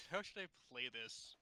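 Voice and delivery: ordinary delivery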